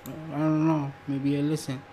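ordinary talk, nothing marked

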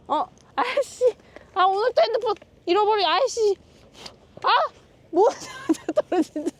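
high, startled and flustered; panicked little cries. Real, not acted. Dog tangled, phone falling.

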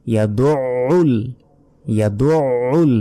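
ইয়াদুউল slow, with the ع pressed from the throat; heavy throat ع in ইয়াদুউল; throaty ayn. Shown as a demonstration. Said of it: "আইন অক্ষর গলার ভেতর থেকে উচ্চারণ হয়".

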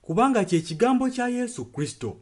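ordinary delivery

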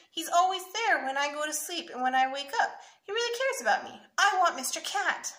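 put-on high, loud, insistent little boy; pleading child. A narrator's reading. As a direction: plead for it like an upset kid. Child pleading for favourite toy.

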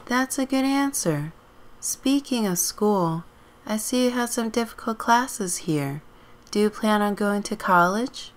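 high, professional interview questions; job interviewer. Acted. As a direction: pitch it up as a courteous hiring manager.

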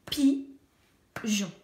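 « Pigeon » scandé pi-geon, un rond par syllabe; « Pigeon » découpé lentement en deux syllabes; syllabes détachées. Shown as a demonstration. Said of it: "deux syllabes". (« Pigeon » prononcé pi-geon, syllabe par syllabe)

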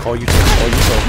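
loud urgent callout; shouted callout. Real, not acted. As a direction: yell it over the gunfire. Callouts in firefight.

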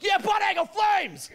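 put-on high, slow drunken fan yell; hockey fan cheer. Calgary crowd cheering the Flames, high slow drunken yell. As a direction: holler it like a rowdy Flames fan.

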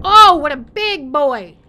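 loud, slowed cooing at a giant; awed loud cooing. Real, not acted. Admiring the huge sea creature up close, loud awed cooing. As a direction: gush over it like a huge pet.

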